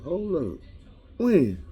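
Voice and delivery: high-pitched startled double take; mock disbelief. Man told she is his wife, mock disbelief. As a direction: stop short, then pitch the question up high. Acted shock at learning he's married.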